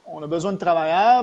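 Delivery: ordinary talk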